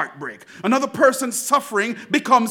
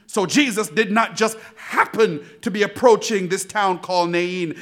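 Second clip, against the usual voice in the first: normal talking voice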